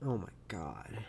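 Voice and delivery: low unvoiced whisper; whispered dismay. Real, not acted. Reacting to raw-egg eaters in a dismayed whisper. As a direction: breathe it out under your voice. Disgusted aside after gross bit.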